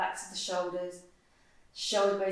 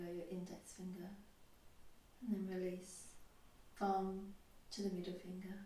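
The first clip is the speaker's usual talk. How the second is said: guided meditation; 12 dB quieter than usual. Acted. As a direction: guide them gently into stillness, softening as you go. Leads guided body-scan meditation.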